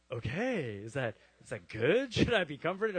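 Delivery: hesitant, wavering questions with comic unease; uneasy doubt, acted. Patient questioning his surgeon's local anesthesia, comically doubtful. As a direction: act out a wary patient, pitch swinging upward.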